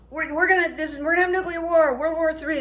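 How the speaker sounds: mock-shout his warning; loud stranger. Stranger announcing nuclear war in grocery store, mock loud. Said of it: "out loud".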